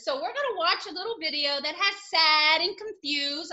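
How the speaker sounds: ordinary delivery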